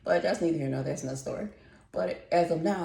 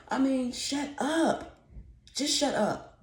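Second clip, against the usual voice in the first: annoyed; 10 semitones higher, slower than usual. Real, not acted. Annoyed at neighbors' baby talk.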